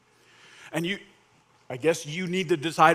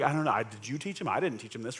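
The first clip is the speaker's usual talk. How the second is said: defensive parent; 5 semitones lower, 6 dB quieter, faster than usual. Retold or imitated. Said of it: "you're like".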